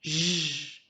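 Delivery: J held as a long French "zh"; isolated J sound. Shown as a demonstration. Teacher models after "à toi", J drawn out as "zh". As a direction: sustain J as a buzzed "zh", no letter name. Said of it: "pour faire le son J".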